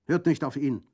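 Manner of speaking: loud protesting shout; shouted together. Acted. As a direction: drown him out. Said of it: "riefen die drei Baricini im Chor". (laut dazwischenrufend)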